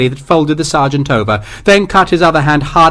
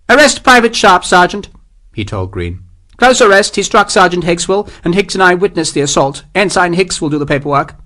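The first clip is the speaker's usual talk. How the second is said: clipped command from a captain; officer's voice; 7 semitones higher than usual. A narrator's reading. Captain reassuring the sergeant, high haughty officer voice. Morris giving orders.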